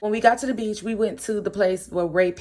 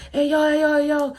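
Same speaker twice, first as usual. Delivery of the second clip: high, louder mock catcall; imitating catcalling; 8 semitones higher, 4 dB louder than usual. Dudes catcalling at the girls in the car, imitated catcall. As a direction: call out like guys hollering at a car. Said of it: "like, catcalling in the car".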